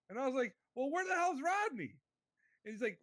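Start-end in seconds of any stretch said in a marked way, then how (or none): 0.8-2.0 much higher, exasperated demand; pitched-up exasperation. Retold or imitated. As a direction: throw up your hands in disbelief. Retelling exasperated question to buddy.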